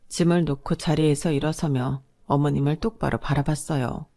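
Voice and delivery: ordinary delivery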